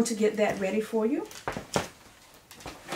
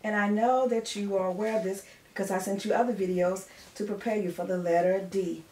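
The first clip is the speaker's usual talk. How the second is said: usual speaking voice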